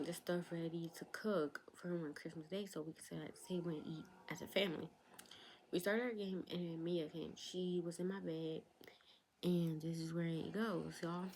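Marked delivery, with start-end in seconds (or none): none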